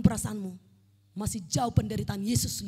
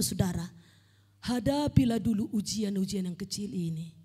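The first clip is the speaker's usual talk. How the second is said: usual speaking voice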